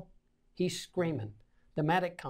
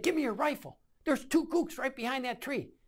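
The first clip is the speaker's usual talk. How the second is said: high, pressing retold demand; higher-pitched combat urgency; urgent demand; 8 semitones higher than usual. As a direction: re-enact demanding a weapon, no shouting.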